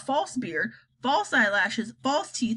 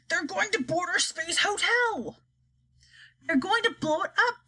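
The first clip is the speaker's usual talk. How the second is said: high, alarmed general's voice; a general raising the alarm; army chief crying out; 9 semitones higher than usual. A narrator's reading. Army chief warns the room, high and alarmed. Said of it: "cried the chief of the army".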